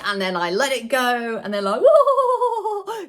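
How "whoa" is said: high, loud laughing whoa; amazed kids. Retold or imitated. Kids cheering as the released balloon zooms off, high, loud and laughing. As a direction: gasp with delight at the flying balloon.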